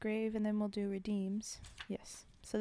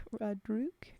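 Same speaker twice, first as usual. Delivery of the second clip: ordinary delivery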